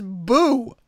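high, loud mock-spooky "Boo"; a hammed-up ghost boo from the telegram.

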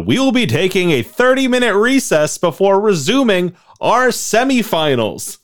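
high, loud stadium announcer. Pretend. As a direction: boom it out over the arena. Arena announcer addressing the crowd.

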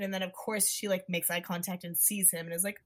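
ordinary talk

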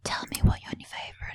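fast, soft whisper. Pretend. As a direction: hush it, eager. Whispered reply in the bit.

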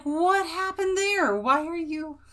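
high-pitched, drawn-out puzzlement; puzzled, high retelling; baffled reaction. Retold or imitated. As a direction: act out bewildered wondering, pitched well up. Quoting own puzzled reaction.

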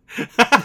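sudden high loud laugh; burst of laughter. Real, not acted. Host laughing at a ten out of ten review, loud laugh. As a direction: laugh out at the joke.

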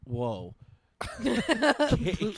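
usual speaking voice

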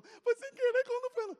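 high, squeaky crying excuse; mock-tearful whining; whiny pleading. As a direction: whimper the lie like a scared kid. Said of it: "Sabia nem mentir".

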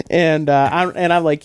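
normal talking voice

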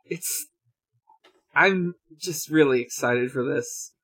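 ordinary talk

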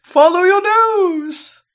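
high, loud, drawn-out call; mock slogan shout. Joking to co-host about following the stink, mock slogan shout. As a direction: belt out a silly catchphrase.

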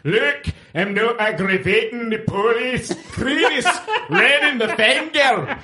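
high, strained mock Scottish voice, laughing through it; constipated-sounding Scottish impression; strained Scottish accent. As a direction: squeeze out a hammed-up Scottish voice while laughing. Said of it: "why is yours constipated".

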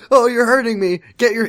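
hammed-up victim cry; mock pained protest. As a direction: squeal as if your wrist is grabbed. Grabbed girl protests being hurt.

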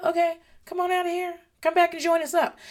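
high, sing-song parent voice; gentle invitation. Acted. Parent welcoming calmed child back, gentle and high. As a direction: coax a child out in a light high pitch.

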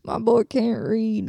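slow Southern drawl; put-on slow Southern voice; Southern accent. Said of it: "I do that accent a lot".

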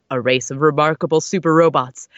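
loud dramatic narration; narrator voice. Acted. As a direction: boom it out like a trailer. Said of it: "your best narrator voice".

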